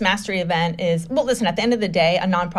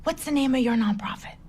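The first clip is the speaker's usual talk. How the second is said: raised, breath-only whisper; hushed aside; 5 semitones higher, 6 dB quieter than usual. Retold or imitated. Man leaning over to her during Tony's talk, whispering. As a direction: imitate someone leaning in to whisper. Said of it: "he leans over and he goes".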